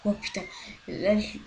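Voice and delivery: usual speaking voice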